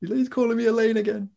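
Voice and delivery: high-pitched imitation of a wailing little sister; mock crying. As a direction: whine it like an upset child, hammed up. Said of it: "going to my mum crying".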